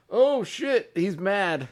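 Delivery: panicked players. Retold or imitated. Players react to coach's hat.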